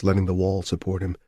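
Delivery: usual speaking voice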